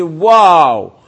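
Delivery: big drawn-out wow, loud; a loud, impressed wow; awed exclamation. Retold or imitated. Voicing awe at Egypt's fortune.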